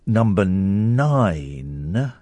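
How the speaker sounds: very slow, stretched-out list reading; drawn-out countdown. Shown as a demonstration. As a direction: draw every word right out.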